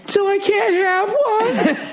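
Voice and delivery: very high, slow fake sob; high sobbing mimicry, drawn out; mock whiny crying. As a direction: whimper like a denied child. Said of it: "That's what you would have said".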